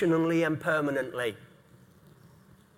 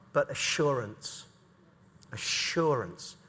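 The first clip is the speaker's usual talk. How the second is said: Assurance said slowly and much softer; soft emphatic stress; 7 semitones lower, 8 dB quieter, slower than usual. Acted. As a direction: let the word Assurance sink in. Preacher stresses the key word.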